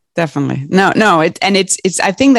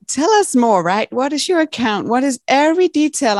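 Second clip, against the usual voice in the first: higher mock automated voice; phone menu voice; 8 semitones higher than usual. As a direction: parrot the phone system's demands. Mocking phone system demands.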